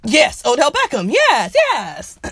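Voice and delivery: loud, high burst of recognition; yes, that's the one, loud and high; excited confirmation. Real, not acted. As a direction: shout it out, delighted to finally get the name. Recognizes the name she was reaching for.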